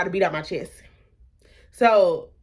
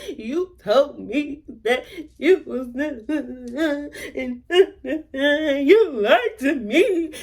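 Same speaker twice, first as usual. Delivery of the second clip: high, slow, laugh-cry wail; retold crying; 7 semitones higher, slower than usual. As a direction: mimic her own heartbroken sob on the phone. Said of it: "homegirl, I'm crying".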